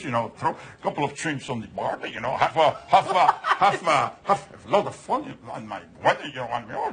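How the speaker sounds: low-pitched, badly faked British accent. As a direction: fumble through a hammed-up British bloke impression. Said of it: "speaking with the British accent".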